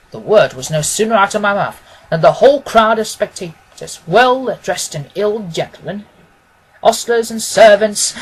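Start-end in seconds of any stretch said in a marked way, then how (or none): none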